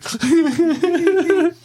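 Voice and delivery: very high loud laugh; squeaky giggle. Acted. Laughing in character.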